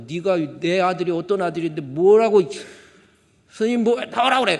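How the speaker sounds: loud, rising into a roar; a tattooed dad storming the school; angry shout. Retold or imitated. Retold angry father at school.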